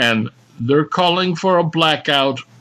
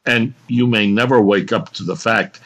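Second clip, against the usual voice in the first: usual speaking voice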